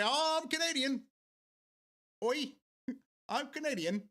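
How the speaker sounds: high-pitched, hammed-up Canadian accent; fake Canadian accent. Streamer pretending to be Canadian abroad, fake Canadian accent. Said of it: "my French Canadian accent a little bit".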